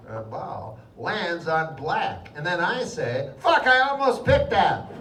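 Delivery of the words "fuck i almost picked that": high, loud retold exclamation; mock excited outburst. Gambler reacting to a lost roulette bet, hammed-up excitement. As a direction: blurt it out as if narrowly missing a win.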